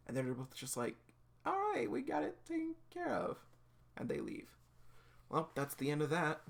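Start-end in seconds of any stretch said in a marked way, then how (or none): 1.4-3.4 very high, squeaky cartoon reply; squeaky put-on voice. Two girls assuring the doctor, squeaky.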